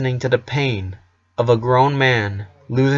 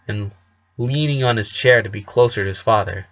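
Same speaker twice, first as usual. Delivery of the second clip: plain reading voice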